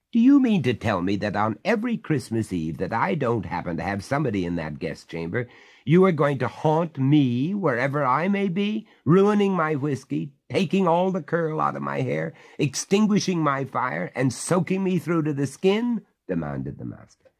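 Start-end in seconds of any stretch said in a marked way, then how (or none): 0.1-5.5 rising outrage. Acted. 5.8-16.0 indignant outrage. Acted. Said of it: "master's outrage".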